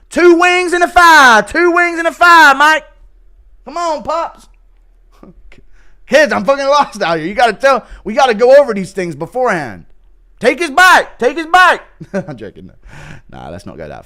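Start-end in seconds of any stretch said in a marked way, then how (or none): 0.1-4.5 high, loud hyped cornerman shout; mock cornerman yell. Cornerman shouting instructions to his fighter. 6.1-9.9 high, loud exasperated fighter shout; mock fighter yell. Fighter yelling at his corner between rounds. 10.4-11.8 high, loud hyped cornerman shout; mock cornerman yell. Cornerman shouting instructions to his fighter.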